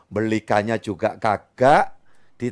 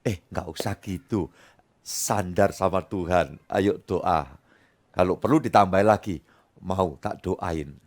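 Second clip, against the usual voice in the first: gentle reassurance; 7 semitones lower than usual. Acted. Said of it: "Kita dapat berkata".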